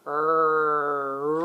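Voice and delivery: drawn-out rrr sound for R; letter sound R. Shown as a demonstration. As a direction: growl out the R sound. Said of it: "an R sounds like this".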